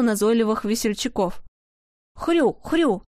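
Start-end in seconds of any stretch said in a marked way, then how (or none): 2.1-3.0 high put-on oink oink; conciliatory grunting. Khryuk the piglet grunting to calm Pavlik. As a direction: grunt like a pig to make peace. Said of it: "примирительно похрюкал Хрюк".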